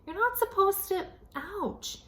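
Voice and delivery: put-on high pained kid complaint; high whiny protest. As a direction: squeal it like a pinched little brother. Ian pinched, protesting.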